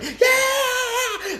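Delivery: high, far-off cheering whoop; audience whoop. Retold or imitated. Vlogger mimicking a talk show crowd, high whoop.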